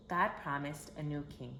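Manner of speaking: usual speaking voice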